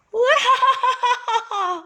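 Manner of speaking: high laugh coming back as an echo; the cave echoing the laugh. Acted. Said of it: "answered the same funny voice".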